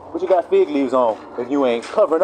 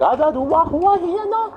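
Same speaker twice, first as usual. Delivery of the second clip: put-on high, slow baby gibberish; baby babble; slower than usual. Baby answering an adult, high babble. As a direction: wail nonsense syllables like a baby. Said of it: "So the baby be like".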